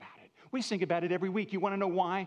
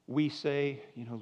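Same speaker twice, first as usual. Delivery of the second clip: ordinary delivery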